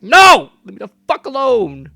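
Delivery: high, loud mock yell at the customer; imagined yell. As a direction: blow up at them, all shouting. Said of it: "I'm like".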